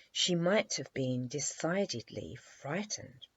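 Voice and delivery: ordinary delivery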